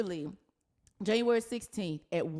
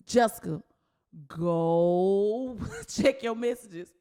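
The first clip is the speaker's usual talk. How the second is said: loud, very slow drawn-out order through a laugh; urgent call; 4 dB louder, much slower than usual. Retold or imitated. Desiree telling Jessica to check her messages, loud and slow. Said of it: "Desiree like".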